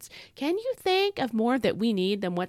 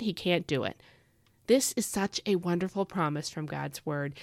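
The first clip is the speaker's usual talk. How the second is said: ordinary talk, nothing marked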